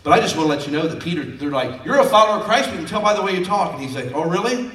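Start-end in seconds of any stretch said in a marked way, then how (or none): 1.8-3.7 loud, raised-pitch accusation; acted crowd accusation, high and insistent; accusing. 4.1-4.7 raised-pitch comeback with a questioning rise; mock-incredulous retort; challenging retort. Peter's defiant retort, acted.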